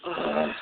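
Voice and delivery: low loud grunt of effort; groan. Real, not acted. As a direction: grunt as you shove it shut. Closing up against the cold.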